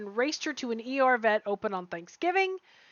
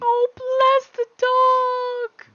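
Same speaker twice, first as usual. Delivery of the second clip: higher, slow, drawn-out delight; gushing; 12 semitones higher, 7 dB louder, much slower than usual. Real, not acted. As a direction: melt over the dog, very slowly. Reacting to a cute dog story.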